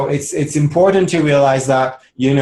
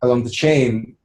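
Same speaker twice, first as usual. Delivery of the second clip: ordinary talk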